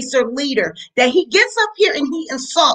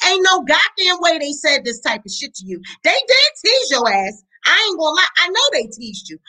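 ordinary delivery

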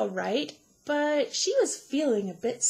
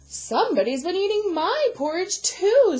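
higher, loud, indignant mama bear; 8 semitones higher, 7 dB louder than usual. A narrator's reading. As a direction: complain as the middle-sized mama bear. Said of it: "said a middle-sized Mama Bear".

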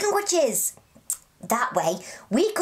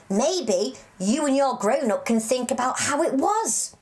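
normal talking voice

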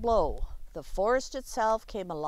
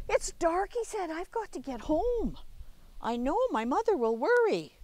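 put-on worried child; 7 semitones higher than usual. Child realizes it's late.

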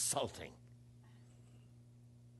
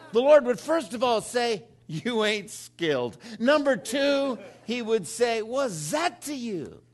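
loud and high, hammering each line; a louder, higher sermon peak; full-force preaching; 6 semitones higher, 4 dB louder than usual. Acted. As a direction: preach it out big to the crowd.

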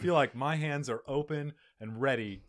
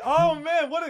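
excited squeal; 10 dB louder, slower than usual. Real, not acted. Reacting to cute dog names.

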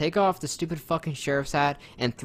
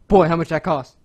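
loud, a bit higher booming dad voice; Terry Crews impression; 6 semitones higher, 11 dB louder than usual. Doing Terry Crews's TV dad, loud and booming. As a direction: boom it like a stern dad. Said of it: "Terry Crews pops out".

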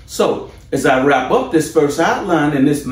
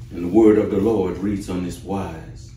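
scripture reading; 4 semitones lower than usual. Acted. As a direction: read it as holy words, unhurried.

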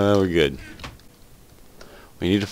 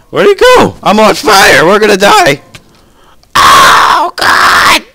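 high loud frantic cries; panicked yelling; 6 semitones higher, 12 dB louder, much slower than usual. Real, not acted. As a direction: shout in burning panic. On fire, panicking.

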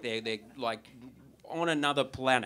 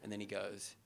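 ordinary delivery

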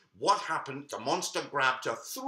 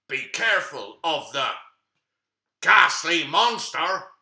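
dramatic warning; 2 semitones higher, 10 dB louder than usual. A narrator's reading. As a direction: boom it out to scare the children. Storyteller warns of the monster.

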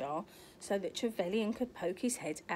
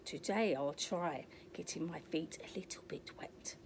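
secret whisper to himself; hushed, sneaky whisper; whispered thought; 3 semitones lower, 7 dB quieter than usual. Acted. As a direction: breathe it out as a private thought.

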